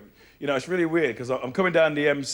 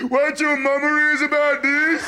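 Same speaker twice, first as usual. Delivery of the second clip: put-on high, drawn-out kid wail; whining little brother; 9 semitones higher, slower than usual. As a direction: whine like a tattling little brother. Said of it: "all I heard all day was".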